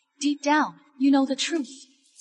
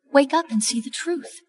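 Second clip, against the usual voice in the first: ordinary talk, nothing marked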